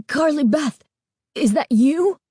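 high, strained frightened question; a scared boy barely getting it out; choked out. A narrator's reading. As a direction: force the words out through fear. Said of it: "he finally managed to choke out".

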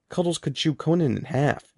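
plain reading voice

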